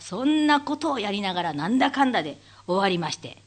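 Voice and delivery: ordinary talk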